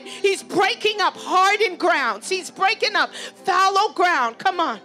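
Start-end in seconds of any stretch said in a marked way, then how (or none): none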